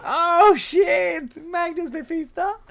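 high, loud astonishment easing off; amazed echo. Real, not acted. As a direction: repeat the name in delighted disbelief, drawn out. Amazed echo of replayed clip.

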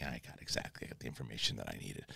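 low, hushed satisfied murmur; smug inner thought. Retold or imitated. Luthen's man thinks smugly to himself, hushed murmur. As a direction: let the smirk sink in quietly. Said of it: "a smirk, like".

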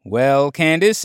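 ordinary delivery

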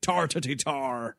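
exaggerated Irish gibberish; Russell Crowe. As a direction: roll out a ridiculous Irish accent. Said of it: "playing it down compared".

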